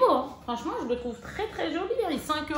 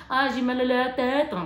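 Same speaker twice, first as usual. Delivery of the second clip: a put-on, louder moan about a headache; mock complaint; 3 semitones higher, 4 dB louder than usual. A mother inventing a diary entry, mock whiny. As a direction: whine it like a made-up diary line. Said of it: "Je sais pas, j'invente".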